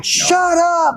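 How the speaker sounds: high-pitched holler of annoyance; imitated neighbors' yell. Witness mimicking people down the hall, yelling. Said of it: "The people down the hall yelling".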